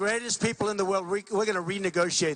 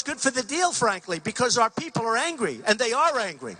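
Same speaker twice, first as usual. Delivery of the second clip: ordinary talk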